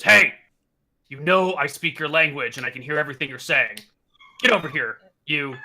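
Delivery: gruff, loud hobgoblin barking orders; angry hobgoblin. Acted. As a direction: snarl it like a brute guard. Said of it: "and goes".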